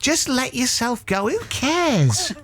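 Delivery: lightly voiced, high and carefree relayed advice; slow down, then lift it high on a careless shrug; Mister Kipling. Psychic relaying the dead cake man's advice, airy and high. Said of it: "He's saying".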